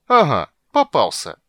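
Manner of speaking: loud, high triumphant shout. Acted. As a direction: crow over the catch. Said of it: "торжествующе возвестил он".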